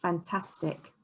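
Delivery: normal talking voice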